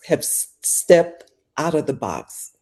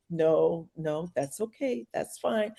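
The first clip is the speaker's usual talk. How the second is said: soft reassurance; 9 dB quieter, slower than usual. Retold or imitated. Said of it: "he's laughing, he's going".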